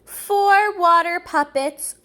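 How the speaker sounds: plain reading voice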